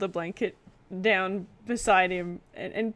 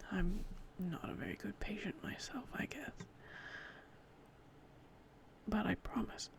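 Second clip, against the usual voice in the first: hushed, much softer confession; whispered promise; 12 dB quieter than usual. Acted.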